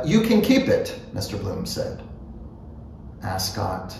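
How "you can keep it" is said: mildly raised character voice, offhand; easy, higher-pitched offer; casual offer. A narrator's reading. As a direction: offer it lightly, pitched a little up. First, casual offer of paper.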